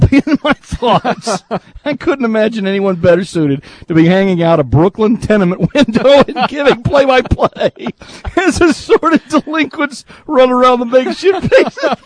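high voice cracking up mid-read; helpless giggles breaking into the reading; reading through laughter. Real, not acted. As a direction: struggle to read the joke while laughing. Cracking up while reading funny email.

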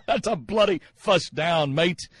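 put-on slow, playful Aussie accent; hammed-up Australian announcer, drawn out; mock Australian accent. Host mimicking a PA call, mock Australian accent. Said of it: "Oh, that's Australian".

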